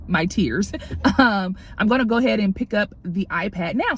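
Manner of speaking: usual speaking voice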